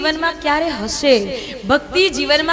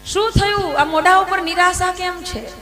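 raised, surprised asking of a worried friend; concerned asking; 7 semitones higher than usual. Acted. Sages ask Narad, concerned. (ઊંચા અવાજે ચિંતાતુર આશ્ચર્ય)

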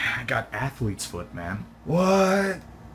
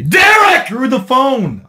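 much higher, loud yell; shout; 12 semitones higher, 13 dB louder, much slower than usual. Acted. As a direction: scream his name through the phone. Shouting for Derek.

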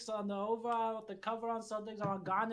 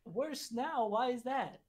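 mildly affronted film star; lightly put-on actor voice, questioning; a touch of celebrity indignation; Ray Liotta. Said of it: "yes, I am Ray Liotta".

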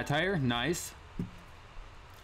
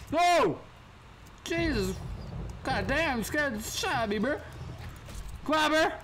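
high yelps of real fright; startled screams; 11 semitones higher than usual. Real, not acted. Gamer startled by a monster, yelping high. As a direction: jump out of your skin and shriek.

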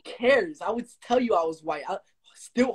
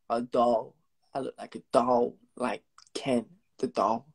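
hushed whisper; 9 semitones lower, 4 dB quieter than usual. Real, not acted. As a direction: breathe it out softly. Whispered aside.

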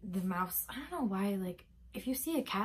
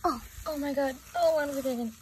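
louder, higher squeals of disgust; real squealing disgust handling the mouse; disgusted wail; 5 semitones higher, 4 dB louder than usual. Real, not acted. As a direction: squeal through the gross part. Picking up the dead mouse.

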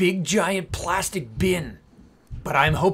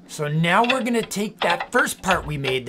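ordinary talk

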